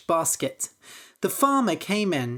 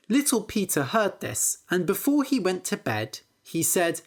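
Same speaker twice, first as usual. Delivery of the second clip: usual speaking voice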